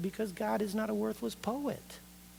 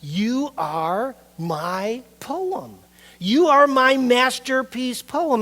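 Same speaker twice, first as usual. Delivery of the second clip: booming voice of God; 7 semitones higher, 11 dB louder, much slower than usual. Acted. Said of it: "God says".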